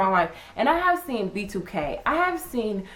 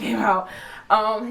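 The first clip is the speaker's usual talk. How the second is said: ordinary talk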